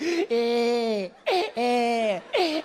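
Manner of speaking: imitated toddler whimper; high, mock crying bleats; child's crying. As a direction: cry like a hurt little boy. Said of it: "he'll come back crying".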